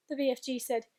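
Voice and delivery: usual speaking voice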